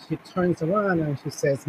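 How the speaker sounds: normal talking voice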